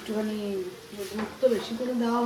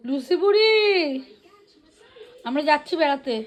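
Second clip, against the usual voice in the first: high, loud cooing to a dog; pet talk; 6 semitones higher, 6 dB louder than usual. Real, not acted. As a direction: coax the dog sweetly.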